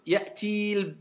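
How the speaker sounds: Ya'ti al- drawn out as ya'tiiil; odd prolonged liaison. Shown as a demonstration. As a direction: drag the ee of ti into the l. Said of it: "ça fait bizarre si tu veux le faire".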